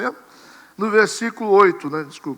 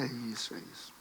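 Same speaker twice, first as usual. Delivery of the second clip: softer, lower, almost to himself; quietly affirming inner voice; hushed agreement; 8 semitones lower, 21 dB quieter than usual. Retold or imitated. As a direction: murmur it as a private realization. Quoting own inner agreement.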